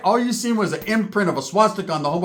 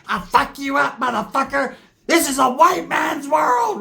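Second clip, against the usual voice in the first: mock fighting shout; 8 semitones higher, slower than usual. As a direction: shout it like a tiny furious brawler. Dwarfwood voiced, fighting, shouting.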